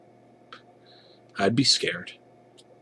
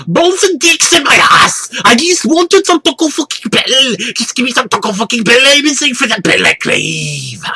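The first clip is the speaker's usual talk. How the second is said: much higher, much louder unhinged screaming; frantic higher, louder ranting scream; 13 semitones higher, 7 dB louder than usual. A narrator's reading. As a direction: scream it like a madman being dragged off. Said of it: "He starts to scream".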